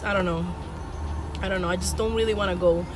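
ordinary talk, nothing marked

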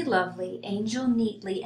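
plain reading voice

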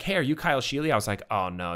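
ordinary talk, nothing marked

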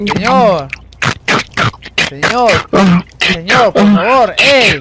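loud, insistent calls; calling out loud. Real, not acted. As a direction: shout at the passenger to get out. Yelling at passenger to get out. (gritando al pasajero que se baje)

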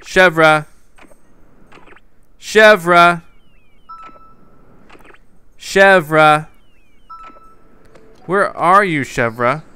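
repeated loud calls; calling out. Real, not acted. Calling out over and over for the missing villager. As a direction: keep calling her name out loud.

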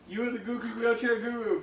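normal talking voice

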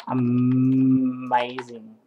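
"amazing" stretched very long on a hummed M; savouring awe. Real, not acted. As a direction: draw out "amazing" on one level pitch. Gushing, word stretched out.

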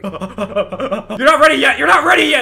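repeated warning rising to a high shout; mock warning, building. Player warning friend off a secret, building mock shout. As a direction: build from laughing warning to full yell.

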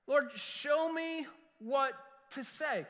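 slow, drawn-out plea, pitched high; crying out. Retold or imitated. Pastor retells praying over what to teach, drawn-out crying out. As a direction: stretch the words out, calling upward. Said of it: "I'm crying out to the Lord".

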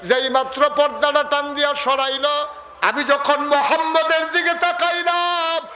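high, awestruck preaching cry; awed exclamation. Acted. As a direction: proclaim it in wonder. Preacher voices Halima's amazement. (বিস্মিত উচ্চস্বরে)

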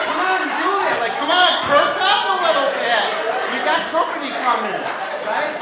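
loud, high coaxing pep talk; mock pep talk. Coaxing own member before exam.

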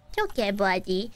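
usual speaking voice

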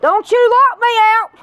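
high, loud outraged yell; angry shout. Acted. Shouting at someone locking her out, furious. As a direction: yell it at the door.

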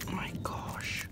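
whispered plea while checking the spider; real whisper. Real, not acted. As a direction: breathe it in real dread. Dreading the spider's fate.

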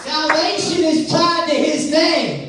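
high, slow, emphatic proclamation; preaching. Real, not acted. Preacher teaching congregation about the name, preaching. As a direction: proclaim it slowly from the pulpit.

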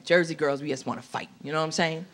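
normal talking voice

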